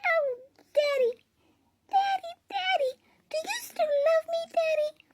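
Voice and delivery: put-on extremely high, squeaky little girl pleading. Shown as a demonstration. Little girl begging Daddy for love, in an extremely high pleading squeak. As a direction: beg Daddy in the tiniest, highest put-on voice. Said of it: "Can go even higher".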